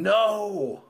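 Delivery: long, high, loud yell; drawn-out desperate cry. A narrator's reading.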